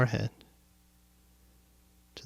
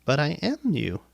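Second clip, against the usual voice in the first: higher voice of the mind, swooping on AM; insistent mind; 5 semitones higher than usual. Acted. Mind claiming to be the listener, insistent. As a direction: insist like a thought claiming to be the self. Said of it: "listen to it say".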